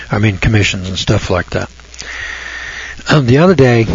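usual speaking voice

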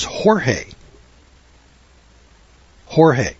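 Jorge said the Spanish way, HOR-hay; Spanish pronunciation. Retold or imitated. Man in Mexico giving the Spanish name, Jorge as "hor-hay". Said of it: "in Spanish is".

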